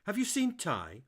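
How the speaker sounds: loud, high calling question; shouting. Acted. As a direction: shout the question through the house. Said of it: "I could hear Tony shout".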